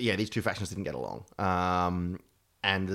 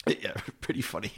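ordinary delivery

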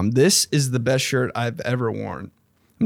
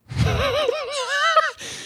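high laugh; 4 dB quieter than usual. Real, not acted. Laughing at own joke.

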